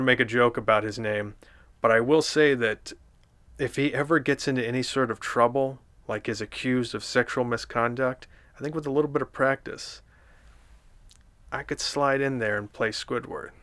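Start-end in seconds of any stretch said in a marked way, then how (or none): none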